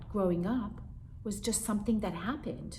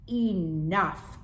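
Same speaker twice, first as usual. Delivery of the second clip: commanding; 5 dB louder than usual. Acted. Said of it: "commanded my mom".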